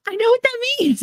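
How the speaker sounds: put-on very high thrilled little-kid voice; excited child. Reliving herself as a small girl at the movie, very high excitement. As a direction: squeal like a three-year-old who gets it. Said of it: "I was like".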